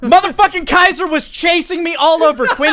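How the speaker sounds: loud, high excited yelling; excited shout. Real, not acted. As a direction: shout it out with glee. Shouting excitedly after win.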